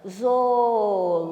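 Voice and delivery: ZOOLÓGICO split into zo-o-ló-gi-co, slow and loud; syllable by syllable. Shown as a demonstration. Teacher has pupils count syllables, "Zoológico" syllable by syllable. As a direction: say "Zoológico" loudly, stretching each syllable. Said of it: "Vamos ver quantas sílabas".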